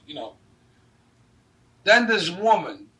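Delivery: usual speaking voice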